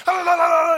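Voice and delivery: high, loud ranting gibberish; mock angry babble. Manager screaming at the umpire in gibberish, loud and high. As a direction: rant nonsense syllables at full blast. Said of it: "all you hear is like".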